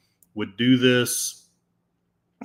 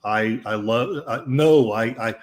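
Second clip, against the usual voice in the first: ordinary talk, nothing marked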